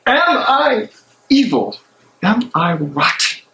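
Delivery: tormented man arguing with himself alone; a conscience breaking under doubt; anguished soliloquy. Acted. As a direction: pace and wrestle with your own guilt aloud. Bonhoeffer's anguished prison monologue.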